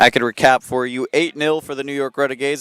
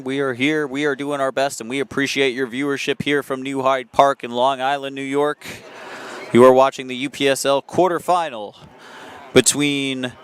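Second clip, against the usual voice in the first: ordinary delivery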